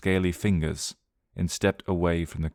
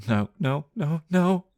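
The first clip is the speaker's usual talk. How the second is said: rising higher with each no, panicked; half screaming half mumbling; 10 semitones higher, slower than usual. Acted. Peggy refusing the creature, high panicked no's. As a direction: terror spilling out as mumbled no's. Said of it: "half screaming and half mumbling".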